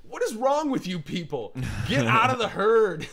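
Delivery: high, loud scolding outburst; loud, worked-up exasperation; exasperated rant. Real, not acted. Rants at Apple's buyers.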